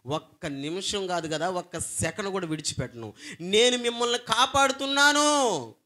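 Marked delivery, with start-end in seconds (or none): 3.4-5.8 loud, high proclamation; proclaiming. Acted. Preacher voicing God's promise, loud and high. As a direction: declare it with full force.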